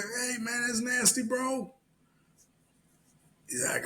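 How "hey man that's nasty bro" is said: high, thin retold yell; mock yelling. Worker yelling at a man outside the hotel, disgusted. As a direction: call out in a high mock holler without full volume. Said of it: "when I was yelling".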